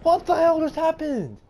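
high outraged yelling; furious at the hacker; panicking gamer. Real, not acted.

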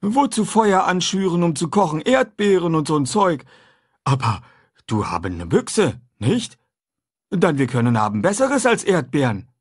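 a simple, eager man talking food; runaway man chatting with the boy; Jim's voice. A narrator's reading. Jim's voice in dialogue.

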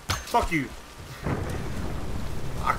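ordinary talk, nothing marked

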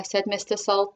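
plain reading voice

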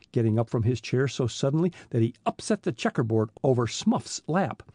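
ordinary delivery, nothing marked

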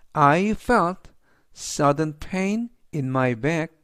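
sudden pain in my back, spoken very slowly; slow dictation. Shown as a demonstration. Teacher reads example sentence slowly to students. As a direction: spell it out slowly for learners. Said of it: "teacher reads model sentence slowly".

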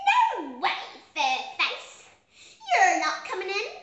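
loud, laughing cheeky pig; cried out. A narrator's reading. Second little pig laughs at the sheepdog, loud and cheeky. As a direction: shout back with a mocking laugh. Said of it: "cried the second little pig".

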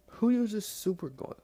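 high, loud disbelieving question; who would do this, baffled; incredulous. Real, not acted. Incredulous at glue in package.

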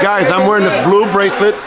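normal talking voice

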